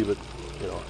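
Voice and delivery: ordinary delivery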